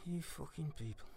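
very soft, slow, low contempt; disgusted undertone. Acted. Hooligan disgusted by interviewer wanting gore, quietly contemptuous. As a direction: say it low, almost to yourself.

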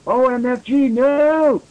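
high, hammed-up cry of dismay; mock shout. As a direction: pretend shout, pitched well up. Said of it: "shouted Lucian".

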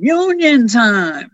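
long rising holler, not full volume; mock crowd scream. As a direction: imitate a whole room calling back, stretched long. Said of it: "the entire room would scream".

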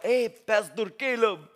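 slow, badly faked African accent; poor imitation of an African accent; horrible African accent. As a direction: greet someone in a hammed-up African accent. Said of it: "that's a horrible African accent".

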